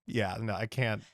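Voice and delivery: normal talking voice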